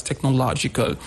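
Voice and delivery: "technological" with LO stressed. Shown as a demonstration. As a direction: stress the third syllable in "technological". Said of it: "On the syllable low".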